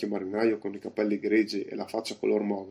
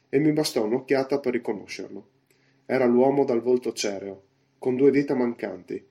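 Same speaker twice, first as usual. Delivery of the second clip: ordinary delivery, nothing marked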